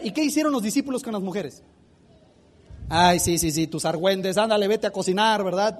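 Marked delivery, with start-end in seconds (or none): 2.9-5.4 low, dismissive brush-off; mocking dismissal. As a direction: wave them off with scorn. Disciples mocking the women. (burla despectiva, en voz baja)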